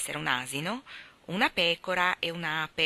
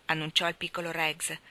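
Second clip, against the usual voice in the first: plain reading voice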